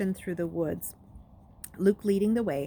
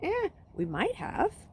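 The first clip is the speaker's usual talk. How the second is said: higher, slow shrugging reply; shrugging boy; 12 semitones higher, slower than usual. Acted. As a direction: toss it off with a shrug. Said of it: "Trey shrugged".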